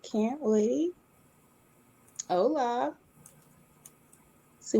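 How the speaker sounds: normal talking voice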